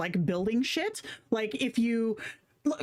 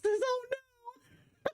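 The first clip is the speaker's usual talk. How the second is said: surprised squeal. Real, not acted. Reacting to a silly picture.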